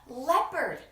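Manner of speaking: usual speaking voice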